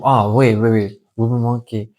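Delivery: ordinary talk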